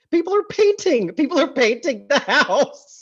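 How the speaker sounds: high-pitched, half-laughing disbelief; incredulous outrage. Real, not acted. Podcaster recounting guests painting the wife's house, incredulous. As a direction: squeal it in appalled, amused disbelief.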